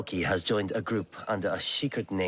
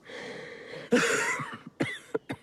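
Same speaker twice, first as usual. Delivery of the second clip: sick cough. Acted. Sick poisoned lord coughs.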